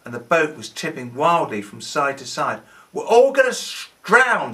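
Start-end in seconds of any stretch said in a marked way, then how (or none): 2.9-4.5 high, loud terrified shout; panicked shout. A narrator's reading. John shouting in terror as the storm hits. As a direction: yell it as the boat tips. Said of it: "shouted John".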